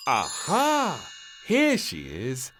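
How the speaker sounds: high, loud, slow aha; triumphant announcement. Acted. Queen finding the princess, triumphant and loud. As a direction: announce the find with relief. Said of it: "announced the queen finally".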